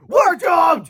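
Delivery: high, loud doomed wail; panicked cry. A narrator's reading. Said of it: "cried the heralds".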